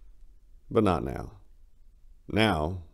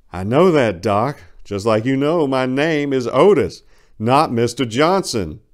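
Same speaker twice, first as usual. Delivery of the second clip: loud, higher, slowing on the name; angry correction; 7 semitones higher, 9 dB louder than usual. Acted. Patient insisting on his real name, loud and angry. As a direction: bite back at the doctor.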